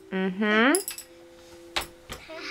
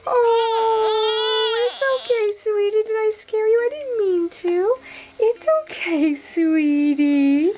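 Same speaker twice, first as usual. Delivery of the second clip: high soothing coo; 10 semitones higher, slower than usual. Real, not acted. Soothing startled baby.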